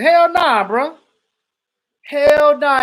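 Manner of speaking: high, loud scoffing no way; outraged at the thought of skinny jeans; emphatic refusal. Real, not acted. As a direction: shoot the idea down hard. Rejecting skinny jeans idea.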